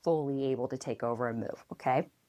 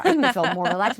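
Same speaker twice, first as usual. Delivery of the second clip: lively, talking through a laugh; 3 semitones higher, 7 dB louder, faster than usual. Real, not acted. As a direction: say it quickly and louder, laughing along. Both laughing after breathing exercise.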